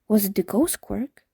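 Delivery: plain reading voice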